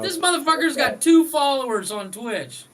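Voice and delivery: loud high exclaim; excited outburst. Real, not acted. Outburst at troll.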